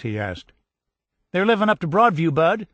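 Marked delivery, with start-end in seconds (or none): 1.3-2.7 high, loud and fast; excited girl. A narrator's reading. Cousin eagerly telling the soldier where his folks are. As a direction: rush it out with delight. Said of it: "She was very excited".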